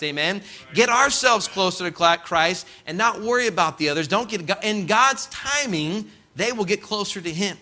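ordinary delivery

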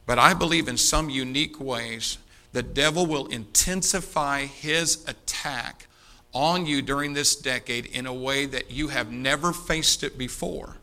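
usual speaking voice

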